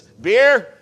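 high, loud, laughing offer of Beer; cheerful drunk. Retold or imitated. Drunk passenger cheerfully offering a beer, high, loud and laughing. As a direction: play the tipsy man now happy again. Drunk man relaxed after calming down.

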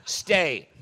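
loud, sharp command to stay; firm dog command. Real, not acted. Preacher turning to command the dog in the room, loud and firm. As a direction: tell the dog to stay, firmly.

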